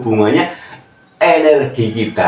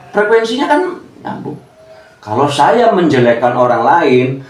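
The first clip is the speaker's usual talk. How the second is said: normal talking voice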